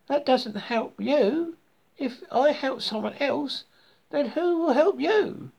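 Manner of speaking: high-pitched frog voice, puzzled; high, drawn-out puzzled frog; questioning frog. A narrator's reading.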